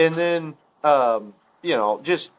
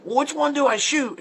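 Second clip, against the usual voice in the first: higher-pitched, urgent retold question; mock excited hunter; 9 semitones higher than usual. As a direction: imitate an eager novice hunter. Voicing excited novice hunter's question.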